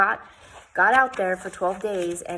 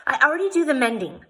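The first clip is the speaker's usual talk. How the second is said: stubborn; 7 semitones higher, 6 dB louder, faster than usual. A narrator's reading. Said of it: "I said stubbornly".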